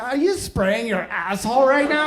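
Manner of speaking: loud, stretched-out mock outrage; outraged wife. Wife catching husband at one a.m., outraged. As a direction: demand it in hammed-up disbelief, dragging the words.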